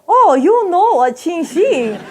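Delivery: high, slow mock vendor accent; drawn-out delighted vendor, hammed up; Chinese street vendor. Vendor greets a returning customer's question, high drawn-out mock accent. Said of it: "the guy goes".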